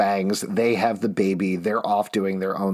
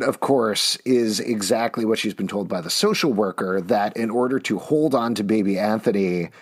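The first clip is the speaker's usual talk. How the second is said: ordinary talk, nothing marked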